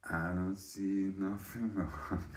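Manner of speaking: slow drawn-out. Real, not acted.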